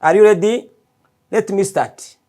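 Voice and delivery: ordinary talk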